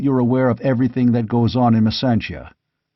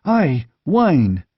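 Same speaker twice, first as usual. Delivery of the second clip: mechanical echo; 4 semitones higher than usual. A narrator's reading. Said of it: "echoed Publio mechanically".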